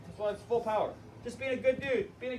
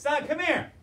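stern grandpa; 8 semitones higher, 4 dB louder than usual. Retold or imitated. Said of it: "His grandpa says".

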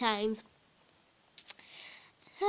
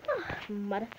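usual speaking voice

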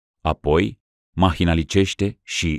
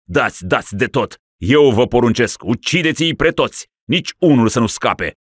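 raging rant; 5 semitones higher, 5 dB louder than usual. Acted. Raving ruler orders slaughter.

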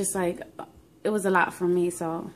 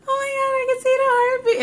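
very high, loud cry-squeal of relief; overjoyed retold outburst, voice shooting up; tearful joy; 6 dB louder than usual. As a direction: squeal it through happy mock tears. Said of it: "I think I started crying".

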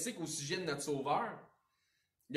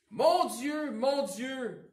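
Mon Dieu cried out high and loud, then easing down; the psalmist crying out to God, loud; 8 semitones higher, 8 dB louder, much slower than usual. Acted. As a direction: cry out the psalm's opening at full voice. Psalm cry read aloud.

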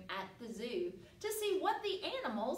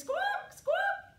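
very high, loud parrot squawks; 6 dB louder than usual. Pretend. Teacher to the children, showing a parrot squawk. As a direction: screech it out like a parrot.

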